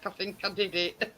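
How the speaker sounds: normal talking voice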